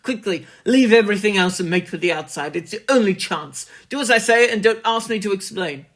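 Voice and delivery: fast, loud urgent warning; urgent frantic plea. A narrator's reading. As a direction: urge him out, quickly and loud. Warren urging Carter over phone.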